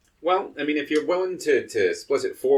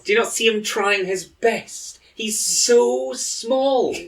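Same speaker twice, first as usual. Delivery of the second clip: much higher, slow fond cooing; 11 semitones higher, slower than usual. Real, not acted. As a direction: coo over something tiny. Defends the tiny robot.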